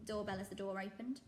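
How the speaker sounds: plain reading voice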